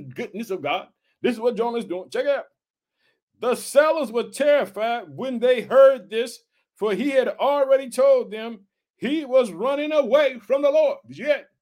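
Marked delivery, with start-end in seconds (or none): 3.4-11.0 slow declamation in separated phrases; scripture reading. Acted. Preacher reading of the lots to the congregation, slow declamation. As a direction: read slowly, pausing between phrases.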